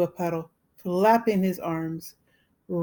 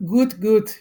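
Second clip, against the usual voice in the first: a loud, slightly higher mock screech; 3 semitones higher, 6 dB louder than usual. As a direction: screech it like a crazed bird. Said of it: "screeched Billy".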